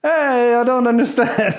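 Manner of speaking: high, loud naive tourist voice; playing dumb tourist. Comic still playing dumb with the thug, high and loud. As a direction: act baffled, wide-eyed. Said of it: "I'm still doing this thing".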